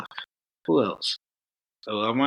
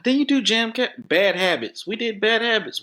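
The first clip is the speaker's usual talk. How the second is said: sudden recall; 11 semitones higher than usual. Real, not acted. Suddenly recalling a shared gig.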